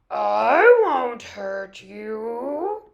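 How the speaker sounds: very slow, loud oily wolf voice; falsely friendly wolf. A narrator's reading. Wolf reassures the treed cat, slow fake friendliness. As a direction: stretch each word into a sly promise. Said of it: "pretending to be friendly".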